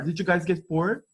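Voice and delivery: ordinary delivery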